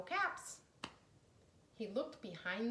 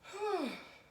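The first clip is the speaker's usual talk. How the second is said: waking sigh; 11 semitones higher, 4 dB louder than usual. A narrator's reading. Peddler waking.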